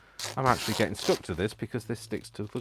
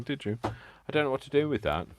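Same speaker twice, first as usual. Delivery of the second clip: ordinary talk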